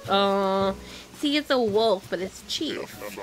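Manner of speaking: ordinary delivery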